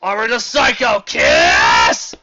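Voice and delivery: slow, high, loud anime battle cry; mock transformation shout. Reviewer mocks the hero's transformation call, slow hammed shout. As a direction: bellow it like a hero transforming.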